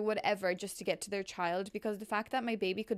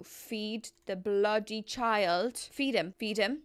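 normal talking voice

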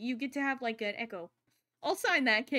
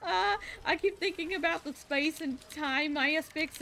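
higher-pitched nerdy enthusiasm; real geeky excitement; excited; 5 semitones higher, 4 dB louder than usual. Real, not acted. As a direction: gush like a total nerd. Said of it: "I get so excited".

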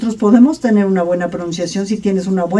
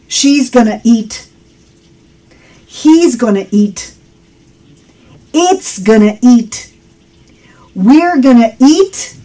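measured, clearly articulated model sentences; deliberate drill. Shown as a demonstration. Teacher drills "gonna eat" for learners, deliberate model delivery. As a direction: leave each short sentence room to be repeated. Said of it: "Ahí te va".

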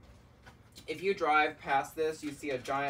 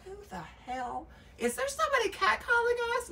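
voicing the horses. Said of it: "they'll, like, turn back".

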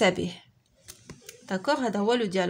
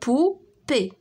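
بوبي in two detached syllables; 3 semitones higher than usual. Shown as a demonstration. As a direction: leave a gap inside بوبي between the syllables. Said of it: "كنسمعوها في لي دو سيلاب". (بوبي مقطعة على جوج سيلاب)

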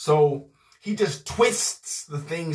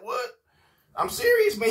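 much higher, amazed voice; mock surprise; 7 semitones higher than usual. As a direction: jump up in pitch, astonished. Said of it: "I'll be like".

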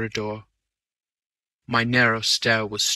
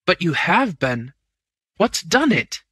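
higher, urgent questions; alarmed; 7 semitones higher, 4 dB louder than usual. A narrator's reading. Manager questioning the wounded man, alarmed. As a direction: press him, alarmed.

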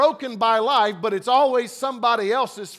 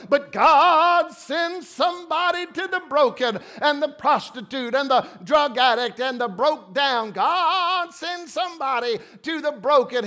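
mock pleading prayer; 3 semitones higher than usual. Preacher mocking churchgoers, hammed-up begging prayer. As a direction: beg God theatrically, swelling on God.

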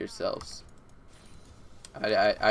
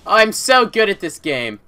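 louder, slower, stress on so; emphatic boast; 8 semitones higher, 11 dB louder than usual. Real, not acted. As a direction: boast it proudly and slowly.

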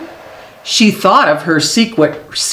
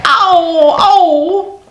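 high, startled little cries; great surprise; 11 semitones higher than usual. Acted. Sal feels her tooth come out, high surprised cries. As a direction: yelp it out in sudden surprise. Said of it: "she said with great surprise".

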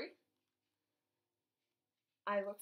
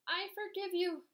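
joyful call, higher and brighter in pitch; happy shout; 8 semitones higher, 6 dB louder than usual. Acted. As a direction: cry it out with glee while dancing. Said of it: "Scribble shouted as he did a happy dance".

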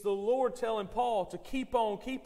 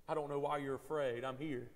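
soft reassurance; 5 semitones lower, 5 dB quieter than usual. Retold or imitated. Pastor voicing Jesus in the storm.

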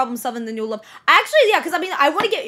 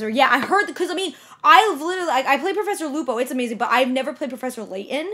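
normal talking voice

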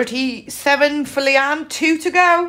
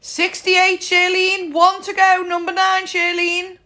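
bingo caller; 6 semitones higher than usual. Acted. Bingo calling.

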